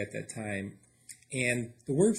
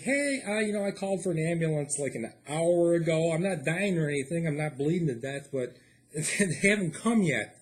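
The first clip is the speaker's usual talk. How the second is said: polite caller on the phone; 4 semitones higher than usual. Retold or imitated. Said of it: "I'm like".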